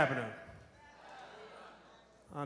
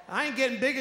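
ordinary talk

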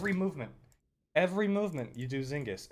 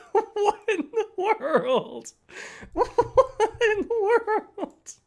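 high, slow, laughing through the words; real giggling incredulity; laughing disbelief; 6 dB louder, much slower than usual. Real, not acted. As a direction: crack up while asking.

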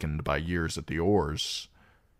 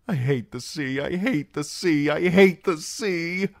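rising panic; 11 semitones higher, 4 dB louder than usual. A narrator's reading. Sam's panicked repeated thought.

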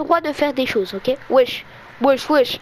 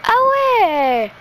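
high delighted exclaim; excited; 8 semitones higher, 4 dB louder than usual. Real, not acted. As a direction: burst out with joy. Excited at upgrade.

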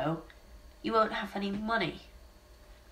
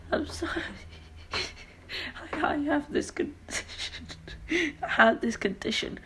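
high, giggling through the words; laughing apology; 10 semitones higher, 3 dB quieter than usual. Acted. Clown explaining his condition, laughing. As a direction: apologize while laughter bubbles up.

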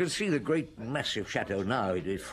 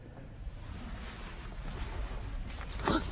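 horrified gasp; 8 dB quieter than usual. Acted. Reaction to death.

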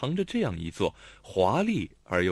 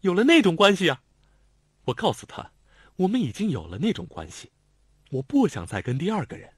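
self-righteous; 8 semitones higher, 5 dB louder than usual. A narrator's reading. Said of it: "理直气壮地说".

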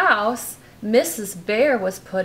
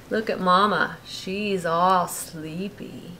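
ordinary delivery, nothing marked

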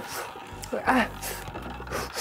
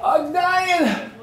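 pained shout; 6 semitones higher than usual. Real, not acted. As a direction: yell out from the burning heat. Burning from the spicy chip.

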